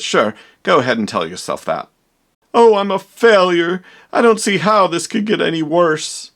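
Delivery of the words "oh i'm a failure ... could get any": hammed-up despair. Scientist wails about failure.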